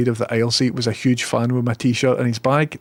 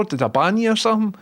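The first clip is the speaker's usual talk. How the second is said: mock surprise; 10 semitones higher, faster than usual. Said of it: "I'm like".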